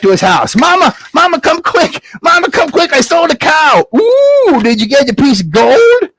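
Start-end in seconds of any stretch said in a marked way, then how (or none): 0.5-3.9 put-on high shouted boy calls; excited boy yelling home; Jack calling Mama. 3.9-6.1 eager mama. Acted.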